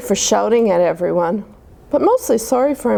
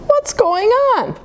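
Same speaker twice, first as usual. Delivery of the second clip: confused squeak; 13 semitones higher than usual. Acted. Confused monkey wakes up.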